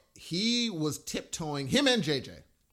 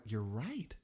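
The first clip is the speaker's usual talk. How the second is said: low, soft admission; quiet retold concession; 7 semitones lower, 10 dB quieter than usual. Son conceding to mom about the clay set, quietly. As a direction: give in softly, voice dropped low.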